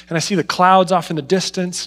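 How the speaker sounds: normal talking voice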